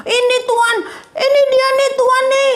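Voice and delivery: high, quick pleading voice of a woman before the king; complaining woman. Acted. A woman pushing her case before the king, high and fast. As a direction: cut in high and fast, eager to be heard.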